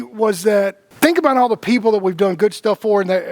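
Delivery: usual speaking voice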